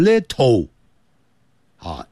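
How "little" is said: LITTLE with a hard puffed T, ending "toh"; mispronounced example. Shown as a demonstration. Teacher warns beginners how not to say it, wrong pronunciation. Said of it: "不要念成little".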